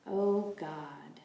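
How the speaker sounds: whispered, very slow prayer; breath prayer. Shown as a demonstration. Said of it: "breathe in and breathe out".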